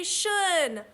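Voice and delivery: "shun" said as one clear syllable, sh plus un; say the suffix. Shown as a demonstration. Teacher modelling the suffix for children, clear. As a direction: model "shun" cleanly for the class. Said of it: "Everyone say shun".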